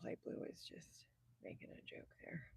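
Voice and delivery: soft whisper, no voice behind it; whispered aside. Real, not acted. Whispering a side comment to the chat about light blue's joke. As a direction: lean in and whisper it like a private aside.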